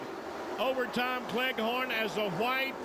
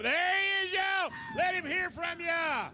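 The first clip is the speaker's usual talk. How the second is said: excited shout; 6 semitones higher than usual. Real, not acted. Announcer shouting to the crowd to cheer the winner. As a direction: hype the stands for the winner.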